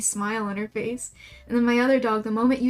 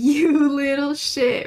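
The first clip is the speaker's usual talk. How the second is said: louder, slowed scolding; playful telling-off of a naughty dog; mock scold; 3 semitones higher, 6 dB louder, slower than usual. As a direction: scold the dog half-laughing. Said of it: "when I tell her".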